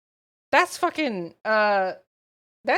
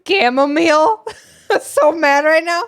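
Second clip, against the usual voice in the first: loud, higher, cracking up while mad; laughing anger; 5 semitones higher, 7 dB louder than usual. Real, not acted. Streamer asking for tea while mad, laughing in exasperation.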